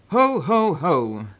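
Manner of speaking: loud, booming jolly laugh; Santa's ho ho ho. Acted. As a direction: announce yourself like Father Christmas.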